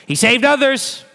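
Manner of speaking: high, loud sneering taunt; a scornful crowd's taunt, shouted up at the cross; mocking parody. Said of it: "parodying the words of the crowd".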